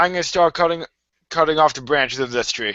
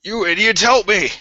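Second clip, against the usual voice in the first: indignant call for help; 4 semitones higher than usual. Acted. Pinned character calls for help.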